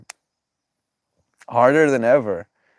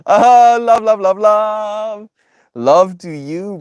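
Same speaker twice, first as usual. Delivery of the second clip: excited; 12 semitones higher, 9 dB louder, much slower than usual. Real, not acted. Showering chat with love.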